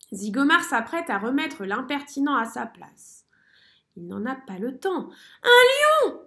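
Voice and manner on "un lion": hurlement très aigu et très fort; cri de peur. A narrator's reading. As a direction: hurler d'effroi. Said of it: "hurle-t-il". (merle terrifié qui hurle)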